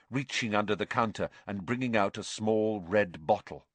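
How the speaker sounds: ordinary delivery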